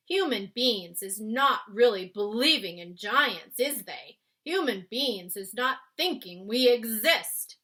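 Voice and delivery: big, loud friendly giant explaining. Acted. Giant explaining to Sophie why he took her. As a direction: boom it out like a kindly giant. BFG voiced in read-aloud.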